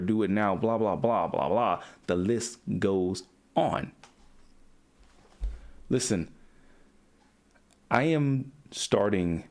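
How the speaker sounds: ordinary talk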